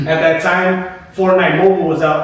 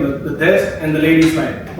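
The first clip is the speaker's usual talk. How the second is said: normal talking voice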